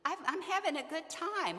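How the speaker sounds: put-on high, breezy teen brushing off a worried parent; carefree busy student. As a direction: toss it off like a kid having too much fun to call home. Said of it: "And they're saying".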